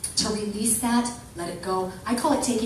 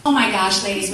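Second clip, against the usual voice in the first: ordinary delivery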